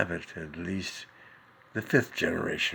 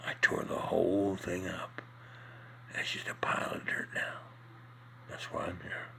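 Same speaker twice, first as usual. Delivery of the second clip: whispered confession; 4 dB quieter than usual. Acted. Joke character confessing quietly.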